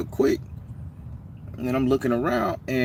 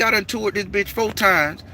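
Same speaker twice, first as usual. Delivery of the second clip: higher, mildly louder retold argument; heated argument; 10 semitones higher, 3 dB louder than usual. The Baron arguing with the stranger over the bench, heated. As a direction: argue your claim to the bench. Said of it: "I say".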